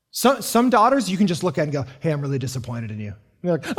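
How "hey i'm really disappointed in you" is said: low, soft, quick letdown; quiet disappointed father. Retold or imitated. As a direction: let her down gently and low. Father scolding a daughter.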